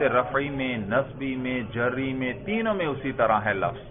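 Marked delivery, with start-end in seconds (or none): none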